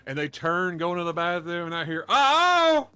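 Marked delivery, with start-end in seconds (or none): 2.1-2.9 very high, loud squeal of dismay; a kid realizing it's too late; child's alarmed cry. Retold or imitated. Little boy in the bathroom wails to his dad, squealing.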